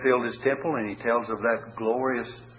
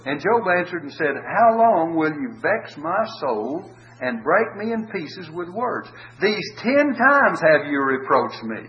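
slow, raised reading voice with a brief laugh; solemn scripture reading; 4 semitones higher, 5 dB louder, slower than usual. Acted. Preacher reading Job's reply to the comforters, slow and solemn. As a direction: lean into each phrase like an old pulpit reader. Reads Job's lament aloud.

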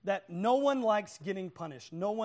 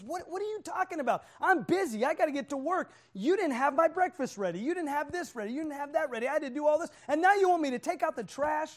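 higher, heated complaint in a rush of grievances; mad husband; 6 semitones higher, faster than usual. Acted. Husband rushing to work yells at his wife, mad. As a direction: act out an exasperated, indignant retort. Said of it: "he gets mad".

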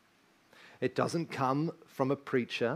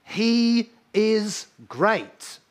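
slow, higher and louder proclamation; God's declaration; 7 semitones higher, 6 dB louder, much slower than usual. Retold or imitated. God speaking over the child, slow grand proclamation. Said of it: "says of this child".

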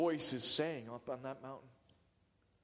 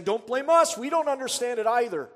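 loud, higher defensive snap from the disciples; defensive retort; 7 semitones higher, 11 dB louder than usual. Retold or imitated. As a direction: disciples fire back at the scribes, louder. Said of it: "the disciples are saying".

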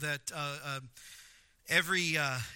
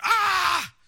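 sudden high, loud yell of frustration; acted scream at losing out; angry scream; 12 semitones higher, 11 dB louder than usual. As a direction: burst out in an exasperated "ah". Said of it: "if you're angry because".

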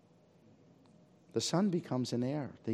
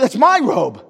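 indignant protest; 9 semitones higher, 15 dB louder, slower than usual. Acted. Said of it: "The elder brother can't even believe".

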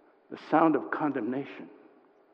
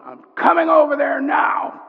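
imitated furious shout, pitched high; screamed threat; 12 semitones higher, 7 dB louder than usual. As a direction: yell it like an enraged caller. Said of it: "he screamed in the phone".

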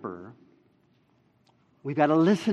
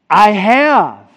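"I have" loud and high; emphatic key phrase; 4 semitones higher, 12 dB louder than usual. Shown as a demonstration. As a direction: proclaim "I have" forcefully. Reveals the key verb.

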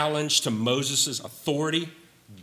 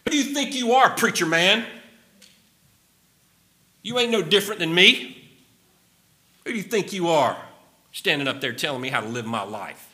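modern rebel challenge; 5 semitones higher, faster than usual. Retold or imitated. Preacher voicing Korah mocking Moses, brash modern challenge. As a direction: sneer it at the preacher. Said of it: "In modern parlance".

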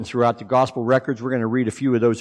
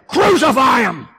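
mock crowd shout; 12 semitones higher, 5 dB louder than usual. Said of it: "they cried again".

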